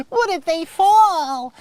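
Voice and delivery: high, loud, drawn-out mock panic; fretting parent. Helicopter parent fears the climbing kids falling, mock panicked. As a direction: stretch it into a hammed-up worried wail.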